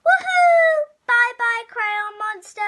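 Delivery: high, loud whoop of glee; joyful shout. Acted. Kid escaping the monster on a plane, whooping loudly. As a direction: cheer like you're flying away free.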